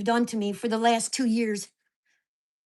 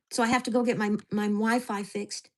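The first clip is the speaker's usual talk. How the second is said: usual speaking voice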